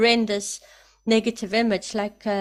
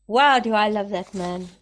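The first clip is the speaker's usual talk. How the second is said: louder, thrilled admiration; delighted exclaim; 4 dB louder than usual. Real, not acted. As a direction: gush with real delight. Delighted by leader's answer.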